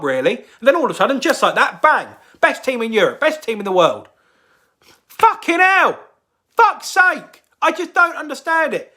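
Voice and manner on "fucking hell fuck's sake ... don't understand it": real fan frustration, voice jumping up; exasperated outburst. Real, not acted. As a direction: throw your hands up, fed up. Angry fan rant after sacking.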